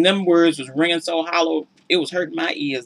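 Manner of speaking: ordinary delivery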